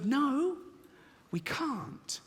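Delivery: high, panicky inner voice blurting it out; a squeaky scared voice in his head; fearful inner voice. Retold or imitated. Comedian voicing the scared side of his mind, high and panicky. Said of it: "This one goes".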